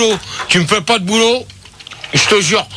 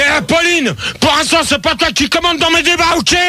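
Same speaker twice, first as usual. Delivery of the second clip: higher-pitched, indignant telling-off; recadrage autoritaire; 5 semitones higher than usual. Real, not acted. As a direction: put her in her place, voice raised in pitch. Animateur recadre la stagiaire qui commande. (recadrage autoritaire, voix qui monte)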